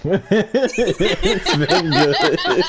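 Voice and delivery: laughing. Real, not acted. Laughing wrap-up.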